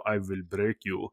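soft, accented villain impression; Ivan Drago. Pilot likening coach to film villain, quiet accented menace. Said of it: "It was very much the Ivan Drago".

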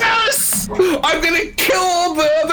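high, gleeful yell; excited shout. Real, not acted. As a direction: shout it out with glee. Excited outburst about backup.

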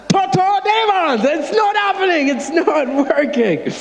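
very high, hammed-up TV exorcist cry; General Nganga. Imitating TV deliverance preacher at demons, high hammed-up cry. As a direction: impersonate a TV preacher failing to cast out demons. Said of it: "You know General Nganga?".

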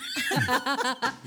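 a burst of real laughter; bright laugh. Real, not acted. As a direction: laugh out loud at the memory. Laughing after her story.

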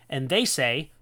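ordinary talk

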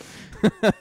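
loud high burst of laughter; full laugh; big laugh. Real, not acted. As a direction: laugh out hard at the joke. Laughs at own joke.

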